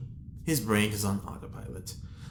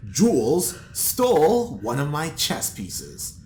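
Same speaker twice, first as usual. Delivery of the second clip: angry accusation; 4 semitones higher, 9 dB louder, slower than usual. Acted. Hugo accusing Jules.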